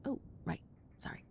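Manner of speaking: soft whisper to yourself; a sheepish whispered realization; whispered aside. Acted. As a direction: breathe it out as an aside. Aside, catching her own slip.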